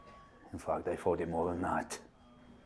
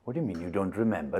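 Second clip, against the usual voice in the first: ordinary talk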